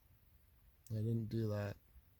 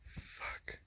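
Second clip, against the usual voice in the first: whispered curse; 13 dB quieter than usual. Real, not acted. Alone in the dark, tense.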